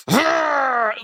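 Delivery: a cartoonish roar of rage; mock frustrated roar. Rhino hears that his men got away and lets out a mock roar. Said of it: "And he's like".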